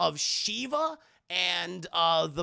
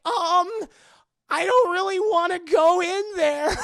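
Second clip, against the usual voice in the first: much higher, louder crying whine; high whiny shout; 12 semitones higher, 11 dB louder than usual. Acted. Willie whining loudly that she won't come in. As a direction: wail it like a spoiled diva.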